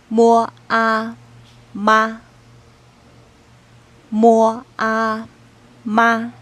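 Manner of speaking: "妈" starts high and falls sharply, said loud for the class to repeat; Mandarin fourth tone. Shown as a demonstration. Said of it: "répétez avec moi".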